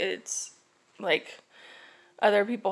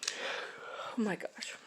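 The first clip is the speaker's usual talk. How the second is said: yawning; 11 dB quieter, much slower than usual. Real, not acted. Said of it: "I don't know why I'm yawning".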